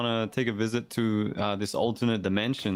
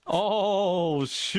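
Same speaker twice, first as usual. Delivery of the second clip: mock surprise; 8 semitones higher than usual. Repeated mock exclamation, high.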